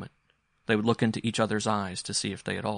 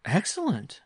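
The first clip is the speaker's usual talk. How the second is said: high-pitched exclamation; husband's exclamation; 10 semitones higher, 3 dB louder, slower than usual. A narrator's reading. Mother answers son with husband's exclamation, exclaiming. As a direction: exclaim it as a borrowed phrase. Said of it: "using one of her husband's exclamations".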